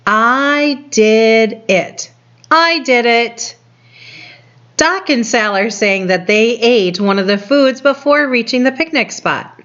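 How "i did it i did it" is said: put-on reading to a toddler. Shown as a demonstration. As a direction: read it out boldly and slowly for little kids. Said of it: "Let's look at what it says".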